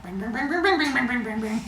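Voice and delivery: high, rapid twangy plinks; sing-song string noises; imitated lyre strumming. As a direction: mimic a kid strumming away.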